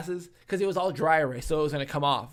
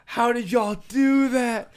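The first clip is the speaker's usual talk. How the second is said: slow, high, dazed question; hammed-up drunk bewilderment; bewildered drunk; 6 semitones higher, 6 dB louder, slower than usual. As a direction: ask slowly like a confused drunk.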